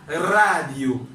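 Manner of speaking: radyo with a long trilled opening R; strong initial R in radyo. Shown as a demonstration. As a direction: roll the R at the start of radyo. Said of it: "li pwononse fuerte".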